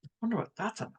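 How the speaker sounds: wondering aloud, unhurried; a touch of playful curiosity; curious musing. Shown as a demonstration. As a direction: muse on it, curious and light. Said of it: "Get curious".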